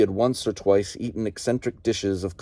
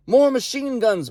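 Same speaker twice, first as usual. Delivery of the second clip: much higher yell over the battle. Acted. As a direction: shout the order across the trench. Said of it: "Bill yelled".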